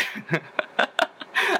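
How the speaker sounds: real, self-mocking giggle; laughing at himself. Real, not acted. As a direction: burst of high, breathy laughter. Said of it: "I guess I sound like a tweaker".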